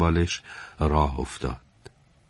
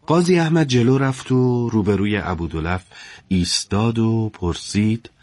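plain reading voice

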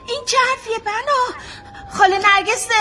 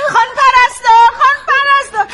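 loud urgent call down the ward; calling for the nurse; 5 semitones higher, 8 dB louder than usual. Acted. Mother shouting for the nurse as the girl gets up, loud and urgent. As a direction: shout for help, alarmed.

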